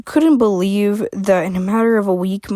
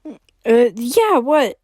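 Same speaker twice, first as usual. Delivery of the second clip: confused; 4 semitones higher, 4 dB louder, much slower than usual. Acted. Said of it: "getting confused".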